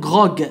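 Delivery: غروغ ending on a hard g as in "go"; hard final g. Shown as a demonstration. Teacher gives a word-final example, hard g in غروغ. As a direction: sound the last g of غروغ hard. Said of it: "في آخر الكلمة تنطق غا".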